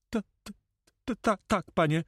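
frightened stammer, pitched very high; terrified, halting notary; trembling voice. A narrator's reading. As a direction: stutter out a scared high answer. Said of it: "odparł zapytany drżącym głosem".

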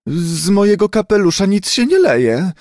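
high, indignant snapping reply; angry retort. A narrator's reading. Hatbox owner retorts to the others in indignant anger. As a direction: spit it out in offended anger. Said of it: "wykrztusiła ze złością jakaś dama".

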